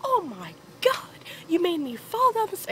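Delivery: hammed-up scolding from a shaken dad; imitating exasperated dad. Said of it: "He's like".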